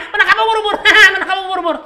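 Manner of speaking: high laughing cartoon voice; hammed-up squeaky laughing; SpongeBob laughing. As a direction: giggle through it like SpongeBob.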